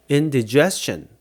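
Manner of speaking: indigestion with short "dih" before stressed jest; shifted stress. Shown as a demonstration. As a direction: say indigestion as in-dih-JES-chun. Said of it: "강세가 바뀌거든요".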